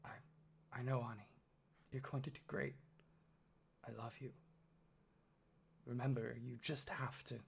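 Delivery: understated tearful mother, quiet pauses; holding back tears. A narrator's reading. Mom sends her son into the trial, lightly tearful. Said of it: "holding back tears".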